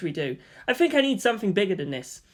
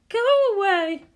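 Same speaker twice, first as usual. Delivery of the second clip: high, loud grumpy cry; sleepy shout; 5 dB louder, much slower than usual. Acted. Misato yells at Shinji from under the futon, high grumpy cry. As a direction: snap at being woken.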